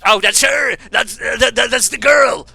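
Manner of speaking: loud, high, stammering excitement; excited recognition. Real, not acted. Streamer spotting the girl everybody posts about, excited shouting. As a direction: blurt it out, thrilled to recognise someone.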